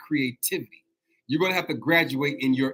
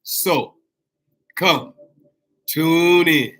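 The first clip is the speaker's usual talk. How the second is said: loud, higher, separate emphatic calls; calling out; 6 semitones higher, 8 dB louder, much slower than usual. Real, not acted. Host summoning listeners to tune in, calling out. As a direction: call them in, each word punched and drawn out.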